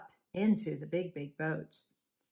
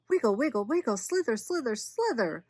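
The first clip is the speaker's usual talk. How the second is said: excited wriggling; 5 semitones higher, 4 dB louder than usual. A narrator's reading. Stick becomes a wriggling snake.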